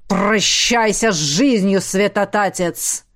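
slow, loud growling threat; deafening dragon roar. Acted. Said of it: "оглушительно прорычал".

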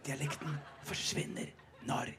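amused whisper, no voice. Shown as a demonstration. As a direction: whisper it with a hidden laugh. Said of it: "når jeg hvisker". (hvisker og ler litt)

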